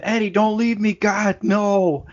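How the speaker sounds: high desperate screaming plea; retold scream. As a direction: scream it like you're being left to die. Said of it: "because he's screaming".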